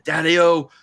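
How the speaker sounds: hammed-up crazed heel bragging; imitating George Steele. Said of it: "he's coming out with that".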